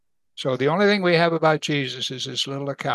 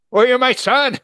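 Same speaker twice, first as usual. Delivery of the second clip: much higher, louder mock retort; exasperated retort; 10 semitones higher, 8 dB louder than usual. Mother answering her son, loud exasperated retort. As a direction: snap back like an exasperated mother.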